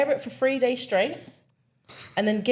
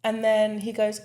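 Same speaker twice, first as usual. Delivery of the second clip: ordinary delivery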